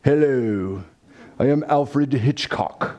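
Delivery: strange, austere man's voice, drawn out; slow, measured imitation of an austere man; Alfred Hitchcock. Film director introduces himself in his trailer, strange austere voice. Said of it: "she hears this rather strange, austere man's voice".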